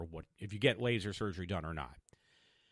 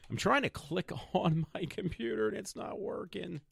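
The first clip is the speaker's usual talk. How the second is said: high-pitched, laughing complaint; exasperated laughing; 11 semitones higher than usual. Real, not acted. Podcaster fighting a stuck computer, laughing in exasperation.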